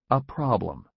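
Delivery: "A problem." read as a calm title with falling tune; chapter title. A narrator's reading. Narrator announcing the chapter title "A problem." evenly. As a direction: announce "A problem." flatly as a heading.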